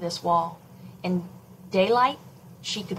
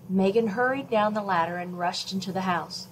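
usual speaking voice

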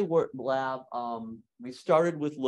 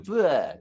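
imitating baby babble sound; 5 semitones higher, 7 dB louder than usual. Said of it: "We're going".

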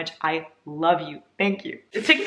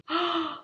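gasp; 10 semitones higher, 5 dB quieter than usual. Real, not acted. Opening fan mail package.

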